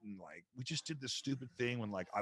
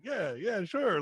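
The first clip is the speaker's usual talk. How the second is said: eager agreement; 10 semitones higher, 6 dB louder, much slower than usual. Retold or imitated. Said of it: "And I was like".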